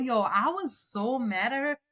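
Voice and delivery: usual speaking voice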